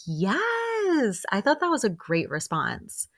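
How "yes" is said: high, drawn-out cheering yes; gleeful approval. Retold or imitated. Recapper cheering a great comeback, gleeful approval. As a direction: stretch a delighted yes up high.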